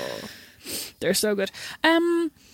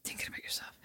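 whispered echo, almost no voice; teasing whisper; 20 dB quieter, faster than usual. Real, not acted. Sister teasing her mother back, in a whisper.

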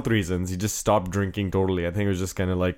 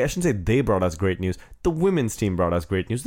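ordinary talk

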